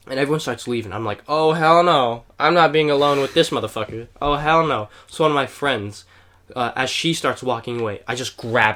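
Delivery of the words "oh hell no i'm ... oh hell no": louder, insistent retold protest; emphatic refusal. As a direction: dig your heels in, loudly refusing. Retells refusing to be left alone.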